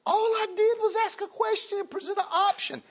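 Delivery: very high, strained mock husband voice; husband's high-pitched mock complaint about a simple question; mock whiny husband. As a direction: squeak it like a baffled husband. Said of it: "wondered why he's like".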